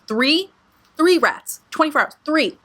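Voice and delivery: THREE punched high, each time; incredulous emphasis. Real, not acted. Telling listeners about rats in twenty-four hours, incredulous stress on THREE. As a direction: hit every "three" high, in disbelief.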